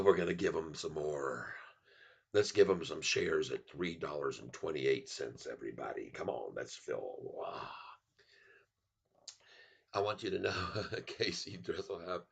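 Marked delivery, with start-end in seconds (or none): 0.0-7.7 very low, sinking softer; low coaxing voice. Acted. Streamer offers everybody some shares, very low coaxing voice. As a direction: coax them, sinking to a deep hush. Coaxing viewers to fill his order.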